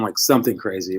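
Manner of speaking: ordinary delivery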